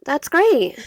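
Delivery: sarcastic reply to an unwelcome sister. Acted. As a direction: lay the false delight on thick. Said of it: "hear the sarcasm in my voice".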